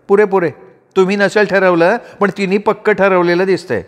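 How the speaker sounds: high-pitched, knowing retort; teasing sister-in-law. A narrator's reading.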